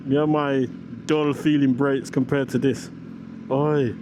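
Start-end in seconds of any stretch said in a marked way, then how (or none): none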